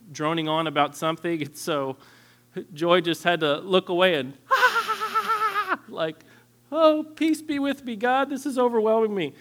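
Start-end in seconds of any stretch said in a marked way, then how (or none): 4.4-5.8 long, high, sustained mock scream; imitated scream. Imitating wife's scream at child. 6.7-9.3 high, loud, hammed-up plea to God; mock overwhelmed. Imitating overwhelmed wife.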